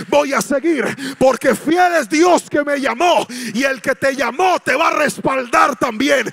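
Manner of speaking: loud, sung-out sermon cadence; preaching at full force. Acted. Predicador anima a la iglesia, predicando a todo pulmón. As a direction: lift the church with a fervent cry.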